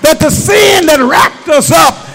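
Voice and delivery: loud, sustained shouted sermon; preaching at full force. Real, not acted. Preacher at the climax to his church, shouted. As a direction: whoop it up at the climax.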